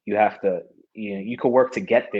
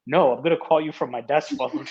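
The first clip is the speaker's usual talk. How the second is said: higher-pitched retold protest; insistent; 6 semitones higher than usual. Athlete begging not to wait for news, comic insistence. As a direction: imitate yourself refusing to wait.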